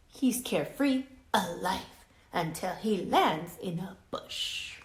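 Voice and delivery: lively, expressive read-aloud voice; reading to children. A narrator's reading. Teacher reading a story to her class, lively and expressive. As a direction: bring the picture book alive for the class.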